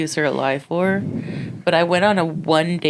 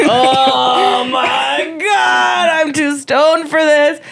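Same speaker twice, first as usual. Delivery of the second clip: high, loud stoned panic; panicked; 10 semitones higher, 4 dB louder, much slower than usual. Retold or imitated. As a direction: yelp it out, overwhelmed. Retelling panicked reaction to call.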